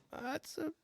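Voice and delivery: high, soft amazed voice; awed squeak. Retold or imitated. Hunter gawking at a giant buck, high soft awe. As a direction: squeak it out in disbelief.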